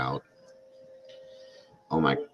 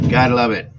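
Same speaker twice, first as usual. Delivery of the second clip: louder, higher sarcastic repeat; 6 semitones higher, 10 dB louder, faster than usual. Real, not acted. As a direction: throw it out loud and sarcastic. Repeats phrase, second louder.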